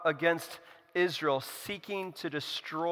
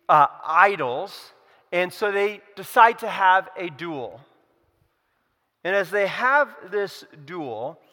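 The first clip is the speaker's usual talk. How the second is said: usual speaking voice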